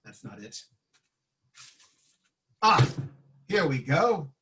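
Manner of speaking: usual speaking voice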